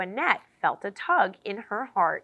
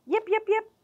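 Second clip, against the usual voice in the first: high little puppy yips; 13 semitones higher, 3 dB louder than usual. Acted. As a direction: yip like a tiny dog. Puppy yipping.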